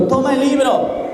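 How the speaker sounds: commanding voice. Retold or imitated. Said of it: "y dijo".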